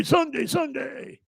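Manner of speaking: very high, drawn-out announcer shout; monster truck ad. Pretend. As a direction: yell it slowly like a monster truck promo. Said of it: "Or is that, is that Sunday".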